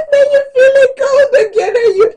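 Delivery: very high, loud, laughing through the words; helpless laughter. Real, not acted. Telling viewers about stripping off in hot flashes, helpless laughing. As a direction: crack up and keep talking over it. Cracks up describing stripping off layers.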